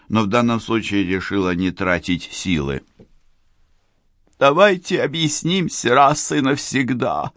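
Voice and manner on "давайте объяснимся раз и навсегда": usual speaking voice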